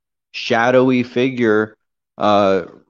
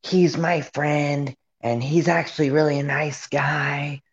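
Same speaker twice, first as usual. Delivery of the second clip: mock crackhead; 4 semitones higher, 4 dB quieter than usual. Imitating a woman defending her friend, mock crackhead voice. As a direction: draw the words out like a strung-out crackhead. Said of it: "she sounds like a crackhead".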